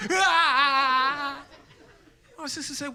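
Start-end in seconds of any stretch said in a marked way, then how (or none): 0.1-1.4 loud hammed-up crying wail; mock wail. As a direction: bawl like a hurt kid. Crying kid voiced.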